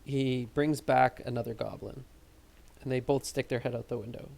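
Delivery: usual speaking voice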